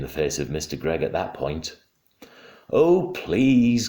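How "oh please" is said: higher, louder plea; pleading cry. A narrator's reading. Mother begging the ducks, pleading cry. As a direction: beg for mercy. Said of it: "cried Missus Gregg".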